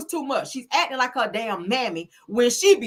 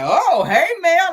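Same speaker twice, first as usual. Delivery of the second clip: loaded hey; 8 semitones higher, 7 dB louder, much slower than usual. Shown as a demonstration. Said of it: "it was one of those types of hey".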